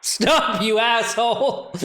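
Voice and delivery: high, drawn-out amused repeat; laughing. Real, not acted. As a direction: repeat it slowly while cracking up. Repeats the line, cracking up.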